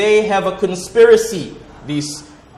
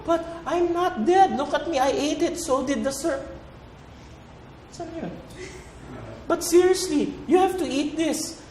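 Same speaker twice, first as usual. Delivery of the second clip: much higher, softer pleading; high comic Eve; 9 semitones higher, 5 dB quieter than usual. Acted. Eve urging Adam to eat the apple, high pitched. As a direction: keep the voice high and insistent.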